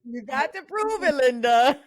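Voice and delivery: high, teasing, half-crying dare. Pretend. Sister teasing her friend, high mock dare.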